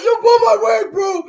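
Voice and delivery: hammed-up yell of a fighter after her wig is pulled; imitating an upset yell. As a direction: play the furious fighter whose wig got pulled. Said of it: "she was going off".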